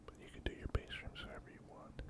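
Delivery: ordinary delivery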